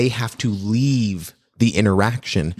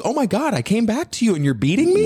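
high, outraged disbelief; shocked dog voice; 11 semitones higher than usual. Retold or imitated. Dog reacting to being scolded, high shocked voice. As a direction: squeak it like a wounded dog. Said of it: "The dog just goes".

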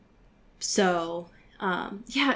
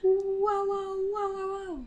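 high wow sound effects; 11 semitones higher, 4 dB quieter than usual. Pretend. Sound effects after patron thanks.

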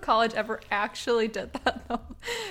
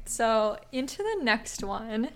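ordinary delivery

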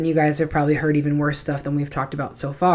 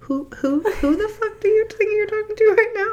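much higher, indignant outburst; who does she think she is; outraged disbelief. Acted. Friend reacting to a rude kid in shocked outrage.

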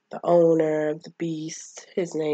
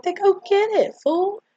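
high, loud retort in a friend's voice; high-pitched impatient imitation; mock bossy yell; 6 dB louder than usual. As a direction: yell it like a kid ordering a pal. Friends order Smalls to fetch ball.

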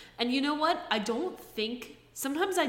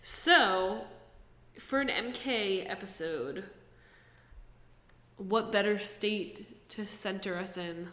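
ordinary talk, nothing marked

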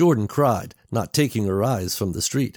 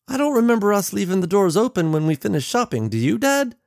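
put-on much higher girl's voice; questioning young daughter; 12 semitones higher, 4 dB louder than usual. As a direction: ask Dad like a puzzled daughter, pitched way up. Daughter questions her father in story.

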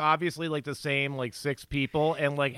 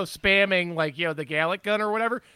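ordinary talk

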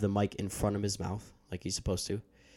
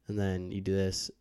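normal talking voice

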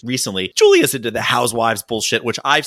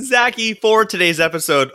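normal talking voice

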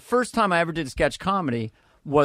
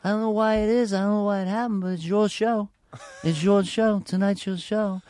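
higher put-on manager voice; Brad Graves impression; 5 semitones higher than usual. Manager to panicked comic in the dressing room, higher put-on voice. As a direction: imitate a manager talking up his client. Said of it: "who kinda talks like this".

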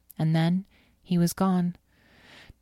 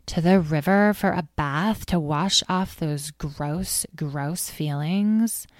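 very slow, disgusted drawl; slower than usual. Acted. Amethyst mocking Azriel's angsty feelings, a slow disgusted drawl. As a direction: stretch out each gross.

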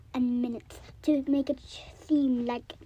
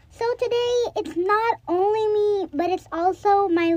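excited; 6 semitones higher than usual. Real, not acted. Greeting viewers, introducing sister.

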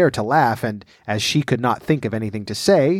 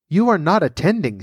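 higher-pitched, mildly severe mouse scolding; 6 semitones higher than usual. A narrator's reading. The mouse scolding Alice for not attending, mildly severe. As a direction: lightly scold an inattentive listener. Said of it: "said the mouse to Alice severely".

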